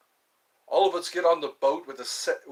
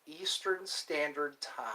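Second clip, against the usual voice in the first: slow, softer, careful wording; said slowly; 6 dB quieter, slower than usual. Real, not acted. Streamer repeating the time zone to viewers, slowly. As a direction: ease slowly through each word. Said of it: "I have to say that slowly".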